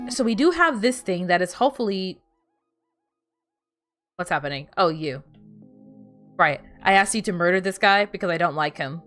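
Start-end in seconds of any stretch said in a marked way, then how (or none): none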